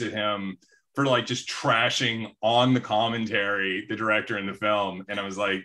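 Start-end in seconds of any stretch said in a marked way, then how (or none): none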